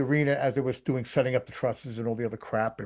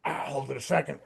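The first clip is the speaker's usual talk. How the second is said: normal talking voice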